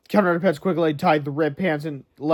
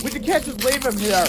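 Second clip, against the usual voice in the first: put-on urgent kid voice; 8 semitones higher than usual. A narrator's reading. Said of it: "said George".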